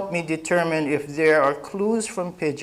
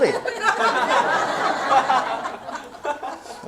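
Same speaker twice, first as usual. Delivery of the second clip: laughing; 14 semitones higher than usual. Real, not acted. Laughing after car ad quote.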